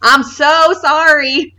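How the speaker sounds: put-on high, drawn-out thick Kansas City accent; slow, hammed-up Kansas City accent. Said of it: "the thickest accent".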